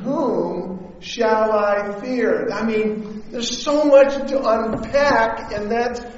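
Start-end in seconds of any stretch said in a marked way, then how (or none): 0.0-2.5 slow, drawn out question; slow rhetorical question. Acted. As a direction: let the question hang. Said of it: "The Lord is my light".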